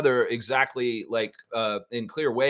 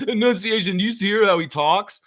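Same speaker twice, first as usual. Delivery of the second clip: mocking snicker into a higher jeer; conniving snark; 4 semitones higher, 3 dB louder than usual. Reporters caught on a hot mic jeering at Scotty, snarky. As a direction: imitate snarky reporters sniggering at a player. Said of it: "sounds like conniving little shits".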